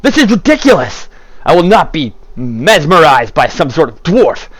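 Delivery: emphatic, swooping outraged refusal; indignant protest. Acted. Proud fighter refusing to be mesmerized by dwarf, indignant. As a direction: protest as if shaking off a spell. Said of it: "he'll stop and be like".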